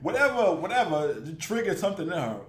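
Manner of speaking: ordinary delivery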